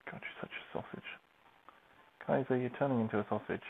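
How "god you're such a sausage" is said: soft whisper to the cat. Real, not acted. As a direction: breathe it fondly at the cat. Cooing at the cat.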